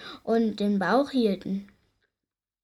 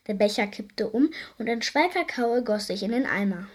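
usual speaking voice

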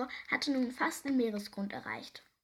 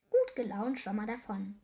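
ordinary delivery, nothing marked

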